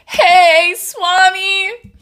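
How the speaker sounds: high, loud happy hello; excited greeting. Real, not acted. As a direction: call out to a friend. Greeting a viewer in chat.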